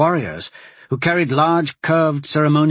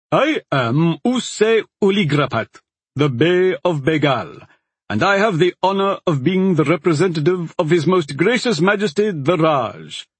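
ambassador voice; 5 semitones higher than usual. A narrator's reading. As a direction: announce yourself with stately pride. Ambassador introduces himself.

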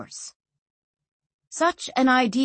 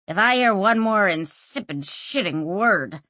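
plain reading voice

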